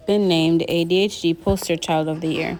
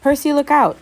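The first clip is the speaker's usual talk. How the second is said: higher urgent warning cry; cried warning; 8 semitones higher than usual. A narrator's reading. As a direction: Annabeth cries out a warning to Percy, higher and urgent. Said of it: "Annabeth cried".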